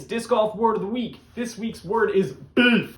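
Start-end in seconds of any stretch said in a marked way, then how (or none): 1.4-3.0 higher, louder, slow announcing; building hype; louder announcement. Acted. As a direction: present the word to the crowd. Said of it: "I'll say it one more time".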